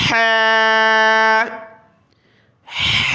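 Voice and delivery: strong throaty ح; forceful throat ح. Shown as a demonstration. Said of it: "حلق سے نکالنا ہے زور لگا کر".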